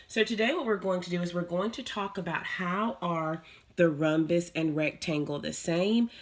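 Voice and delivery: normal talking voice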